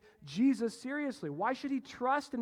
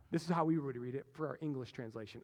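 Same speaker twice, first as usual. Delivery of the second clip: normal talking voice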